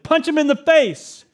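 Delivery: high, loud mock answer to his sons; joking loud advice. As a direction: blurt it out as a joke. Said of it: "I said".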